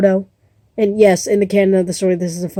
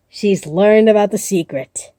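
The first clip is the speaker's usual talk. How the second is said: ordinary delivery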